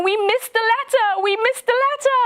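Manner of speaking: high, wailing cry of despair; wailing monk. Retold or imitated. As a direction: wail it like a broken old monk. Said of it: "the monk is crying and he's saying".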